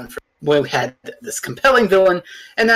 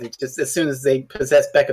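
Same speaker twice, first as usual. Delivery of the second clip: ordinary delivery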